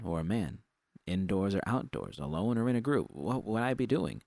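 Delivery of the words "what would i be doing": usual speaking voice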